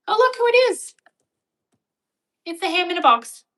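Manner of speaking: light up at a favourite arrival; delighted greeting. Real, not acted. Streamer greeting a familiar arrival, high and delighted.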